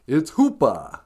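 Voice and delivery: put-on light New York accent, dropped R; Mister Hooper. Old shopkeeper correcting Big Bird, lightly done New York accent. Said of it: "that kind of Eastern accent in New York".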